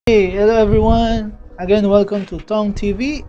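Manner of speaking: ordinary delivery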